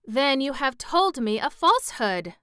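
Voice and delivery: loud, high-pitched, indignant cry; angry accusation. A narrator's reading. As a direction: accuse them of lying, angrily. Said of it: "cried Glinda angrily".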